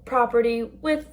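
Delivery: mock Epcot exit announcement; park announcement spiel. Shown as a demonstration. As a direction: read it like the closing park announcement over the speakers. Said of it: "So like that, but smoother".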